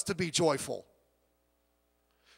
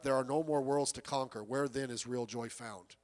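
hushed lament; 9 semitones lower, 8 dB quieter than usual. Retold or imitated. Quoting weeping Alexander.

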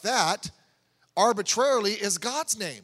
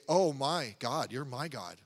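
softly, lower, as a sincere prayer; reverent prayerful; 6 semitones lower, 5 dB quieter than usual. Shown as a demonstration. Preacher showing the right way to say it, softly prayerful. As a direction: say it low and tender, meaning it. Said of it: "you're saying it".

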